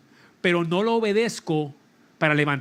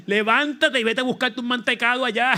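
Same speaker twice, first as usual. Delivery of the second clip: higher, louder, mock-scolding voice of his own body; 7 semitones higher, 3 dB louder than usual. Preacher voicing his sore body scolding him, higher and louder. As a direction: play the aching body nagging him to quit. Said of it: "todo el cuerpo me grita".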